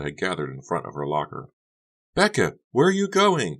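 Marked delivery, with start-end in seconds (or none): 2.1-3.6 high, loud call across hall; calling after. Acted. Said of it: "Carrie called after her".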